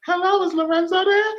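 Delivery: put-on boy calls the house and asks the mother for Lorenzo; high-pitched voice. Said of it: "when he had his high-pitched voice".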